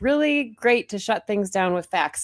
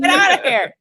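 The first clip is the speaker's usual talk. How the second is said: put-on loud gruff old-senator voice; Sanders impression; 5 semitones higher, 7 dB louder than usual. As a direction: bark it out like a fed-up senator. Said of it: "Sanders like".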